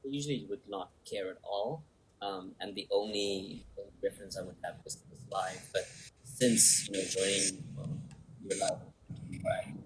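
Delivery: faint and distant from the microphone; speaking away from the mic; far from mic. Real, not acted. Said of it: "I can barely hear you".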